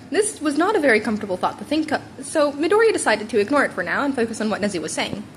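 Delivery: ordinary delivery, nothing marked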